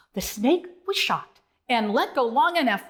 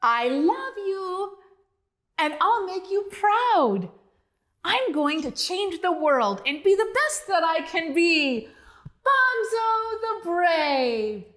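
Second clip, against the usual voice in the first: heartfelt farewell; 6 semitones higher than usual. Acted. Bonzo's farewell to family.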